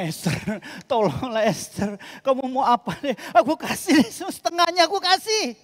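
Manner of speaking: hammed-up king pleading and chuckling at Esther; begging, laughing king. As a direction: beg her like a stuffed, giddy king. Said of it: "Dia ngomongnya".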